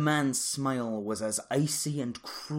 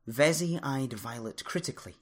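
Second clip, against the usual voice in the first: usual speaking voice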